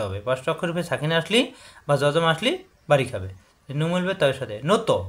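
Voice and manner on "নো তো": নো তো with a bounced ত; qalqalah bounce. Shown as a demonstration. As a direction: push off the ত in নো তো.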